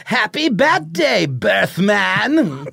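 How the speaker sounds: very high, slow sing-song clown; drawn-out gleeful clown greeting; Joker voice. Acted. Joker gives Batman present.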